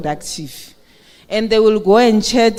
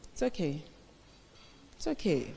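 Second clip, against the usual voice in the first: soft self-soothing; 5 semitones lower, 14 dB quieter than usual. Acted. Calming herself after being shouted at.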